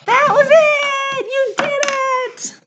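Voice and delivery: high, drawn-out, loud celebration; excited cheer. Real, not acted. Cheers after the song.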